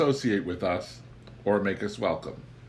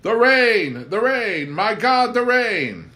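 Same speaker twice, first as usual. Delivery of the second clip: high, loud anguished wail about the rain; louder, much higher overwrought despair; melodramatic moan; 12 semitones higher, 8 dB louder, slower than usual. A narrator's reading. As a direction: ham it up like a tortured novel hero.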